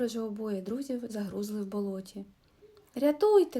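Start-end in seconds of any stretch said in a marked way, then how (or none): 2.9-3.6 loud, higher cry of help; shouting for help. A narrator's reading. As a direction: yell out stuck in a swamp. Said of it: "кричав Расмус".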